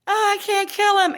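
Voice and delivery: high-pitched mock reluctance; mocking whine. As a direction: mimic a softhearted owner, pitched high. Mocks reluctant dog owner.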